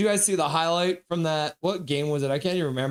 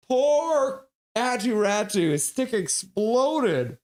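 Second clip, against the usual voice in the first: mock pity; 7 semitones higher, slower than usual. Real, not acted. Pities player with broken stick.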